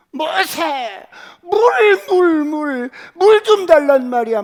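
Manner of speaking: high furious yelling for water; angry crowd shout. Acted. Grumbling people yelling at Moses, furious. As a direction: scream the demand at Moses.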